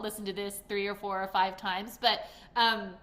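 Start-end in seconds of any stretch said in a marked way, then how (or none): none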